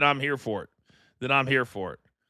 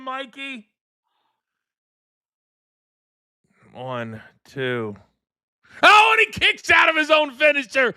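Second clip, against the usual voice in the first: wrestling commentator; 8 semitones higher than usual. Real, not acted. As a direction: roar out the kickout like a ringside caller. Calling a kickout live.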